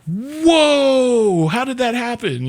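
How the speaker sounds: high, amazed whoa; mock surprise. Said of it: "and you're like".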